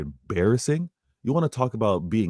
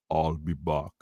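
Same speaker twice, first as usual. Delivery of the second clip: Arnold in Terminator; 6 semitones lower, 7 dB quieter, slower than usual. Retold or imitated. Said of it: "like Arnold in Terminator".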